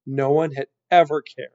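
usual speaking voice

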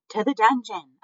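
high, loud cry from a crowd. A narrator's reading. Mouse in the crowd demanding the dungeon, crying out. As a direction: cry out for punishment. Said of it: "a voice cried out".

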